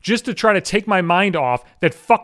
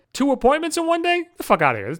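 mock disbelief; 9 semitones higher than usual. Mock incredulity.

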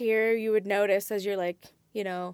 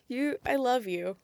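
ordinary talk

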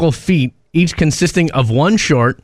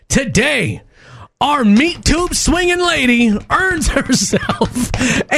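slow, high announcer build-up; milk the suspense like a game show host; drawn-out score announcement; 9 semitones higher, slower than usual. Acted. Co-host announcing the caller's final score, slow and grand.

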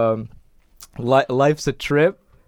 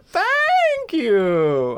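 very high, stretched-out thank-yous; imitated game sound bite. Friend mimicking a seller after each bomb purchase, high squealed thanks. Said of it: "that sound bite of him just go".

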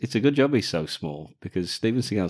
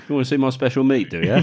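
loud, high, fast mock butcher patter; hammed-up butcher; 6 semitones higher, 9 dB louder, faster than usual. Co-host playing Seagal's butcher with his special meat, hammed-up and loud. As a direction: belt it out as a cheeky comic offer.

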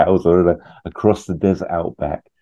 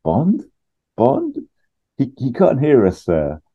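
calling Bond; 8 semitones higher than usual. Retold or imitated. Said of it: "Q says".

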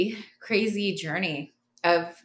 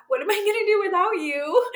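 high, fast and louder, mock-panicked; desperate high plea; 14 semitones higher, 6 dB louder, faster than usual. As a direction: squeak it out like you're lost without her. Pleading quote to her caregiver.

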